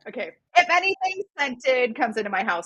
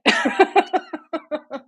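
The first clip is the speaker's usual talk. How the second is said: laugh; 8 semitones higher, 3 dB louder than usual. Retold or imitated. Laughing mid retold quote.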